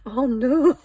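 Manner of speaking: loud, high cry of dismay; dismayed exclamation. Real, not acted. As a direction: cry out at a sudden mishap. Reacts to a sudden mishap.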